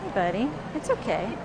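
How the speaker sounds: soft, gentle reassurance; hushed and calming; soothing a scared bunny. Real, not acted. Calming a rabbit at judging.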